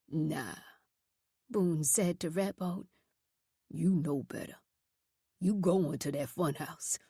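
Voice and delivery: low, hard voice of a cruel superintendent; deep, scornful warden voice; menacing superintendent. A narrator's reading. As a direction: growl it down at the boys, threatening. Boone passes sentence.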